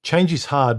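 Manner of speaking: ordinary delivery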